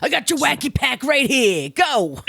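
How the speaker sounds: high-pitched mobster brush-off; mobster type. Retold or imitated. Mob type shooing kids from candy store, gruff mock mobster. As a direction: shoo them off like a TV mobster. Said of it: "Tony Soprano types were sitting in there".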